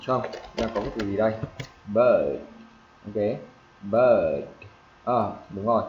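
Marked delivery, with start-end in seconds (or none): 1.9-2.4 "Bird" drawn out with a falling tune; long vowel. Shown as a demonstration. Teacher modelling final D, "Bird" held long. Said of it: "em kéo dài". 3.8-4.4 "bird" held long and sliding down; long vowel. Shown as a demonstration. Teacher repeating for students, "bird" stretched out. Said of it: "em kéo dài".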